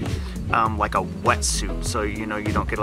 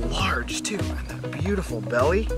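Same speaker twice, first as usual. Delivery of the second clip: whispered; 3 dB quieter than usual. Real, not acted. Admiring snake on camera.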